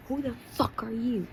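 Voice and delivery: high-pitched, indignant question; bully's challenge. A narrator's reading. First bully to the kid who interrupts, indignant challenge. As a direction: throw it out high and affronted.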